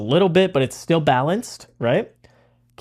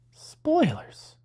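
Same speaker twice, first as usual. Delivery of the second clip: mock hushed; 13 semitones higher, 6 dB quieter than usual. Teasing aside repeated.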